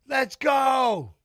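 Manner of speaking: loud, high cheer; hype shout. Real, not acted. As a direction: fire up the fighter. Fight starts, cheering.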